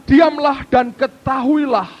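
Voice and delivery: high, loud and slow declaration; emphatic proclamation. Real, not acted. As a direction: proclaim it forcefully, stretching each word. Repeats verse emphatically in preaching.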